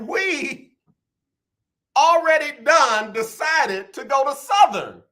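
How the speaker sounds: high, slow, emphatic proclamation; hammed-up emotional declaration. Father repeating his speech to his daughter, hammed-up emotional declaration. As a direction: draw it out high like a grand announcement. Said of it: "I said it just like that".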